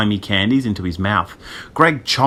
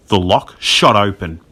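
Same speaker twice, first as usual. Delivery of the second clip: plain reading voice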